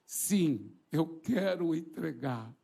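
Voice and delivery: tearful slow acceptance, fading low; imitated crying. Freed woman accepting Jesus, imitated crying, slow and sinking. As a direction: say it haltingly through mock tears. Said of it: "E ela, chorando, disse assim".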